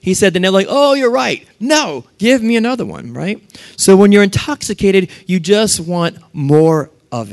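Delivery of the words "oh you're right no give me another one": high-pitched mock pleading; imitated drunk passenger. Drunk passenger to flight attendant, hammed-up demand. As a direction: play a tipsy customer pushing for another.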